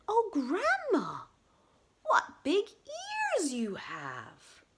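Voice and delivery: put-on high, slow girlish wonder. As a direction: pitch it up and stretch it in amazement.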